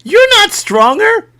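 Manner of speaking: put-on squeaky indignant kid protesting; shrill bratty protest, very high; high whiny kid. Kid insists he's stronger.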